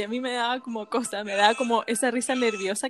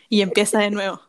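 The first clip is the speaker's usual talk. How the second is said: ordinary delivery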